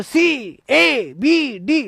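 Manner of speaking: drawn-out, swooping mock answer call. Teacher mimics students shouting out option letters, mock calling out. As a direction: stretch each letter in a mimicking sing-song.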